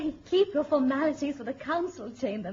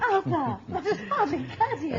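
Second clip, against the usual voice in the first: laughing rebuke; 5 semitones higher, 6 dB louder than usual. Acted. Queen chiding husband, laughing.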